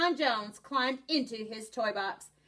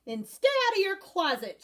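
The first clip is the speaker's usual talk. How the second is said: higher, firm parting order; stern call; 9 semitones higher than usual. A narrator's reading. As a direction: call it after him sternly. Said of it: "she added".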